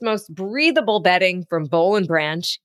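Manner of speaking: ordinary talk, nothing marked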